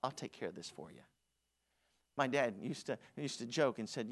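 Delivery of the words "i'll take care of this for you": David calmly soothing King Saul; cool teen David. Retold or imitated. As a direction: shrug it off for the king. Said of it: "And he says".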